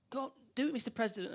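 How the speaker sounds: high, soft taunting; retelling a villain goading the president, lightly hammed; mock taunt. Dying man taunts president.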